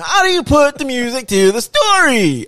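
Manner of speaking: high, loud put-on Cosby voice; hammed-up high Cosby-style question; Bill Cosby impression. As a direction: ham up a squeaky Bill Cosby. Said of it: "Sound like Bill Cosby".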